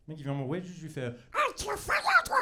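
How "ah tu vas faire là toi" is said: extremely high, loud freak voice; weirdest possible voice. Shown as a demonstration. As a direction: squeal it in the most bizarre voice you have. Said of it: "je fais la voix la plus bizarre que je sais faire".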